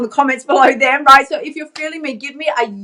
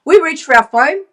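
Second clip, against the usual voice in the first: ordinary talk, nothing marked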